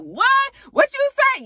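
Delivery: very high, sharp what; shocked disbelief. Retold or imitated. Sierra hearing BK cheated, very high shocked. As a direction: hear scandal and can't believe it.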